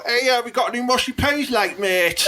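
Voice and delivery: high-pitched Teesside chip-shop customer; Teesside accent. Acted. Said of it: "that's a Teesside accent".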